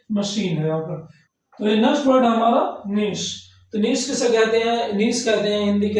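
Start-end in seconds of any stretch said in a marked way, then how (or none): 0.1-1.1 मशीन said with sh, "mashin", lower pitch; correct pronunciation. Shown as a demonstration. Teacher confirming machine's sh sound. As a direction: settle on मशीन with sh, not k. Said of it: "मशीन या मकीन नहीं पढ़ेंगे".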